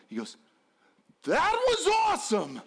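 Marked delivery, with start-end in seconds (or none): 1.2-2.6 very high, squealed delight; imitated child bursting with excitement; thrilled kid. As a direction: squeal it in sheer joy. Son suddenly thrilled.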